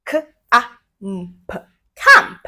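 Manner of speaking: each sound said apart, then the word; segmented blending. Shown as a demonstration. Teacher showing kids how to blend, sound by sound. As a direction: pause between K, ah, mm, then say can. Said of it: "My turn".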